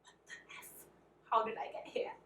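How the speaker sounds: hushed, voiceless panic to oneself; frightened whispering, under the breath; retold scared whisper. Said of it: "scared out of my mind".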